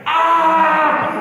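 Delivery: high, loud drawn-out yell; acted scream. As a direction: scream it out in comic horror. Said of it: "I'm screaming".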